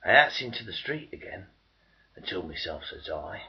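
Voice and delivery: ordinary delivery, nothing marked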